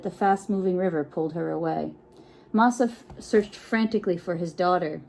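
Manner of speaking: plain reading voice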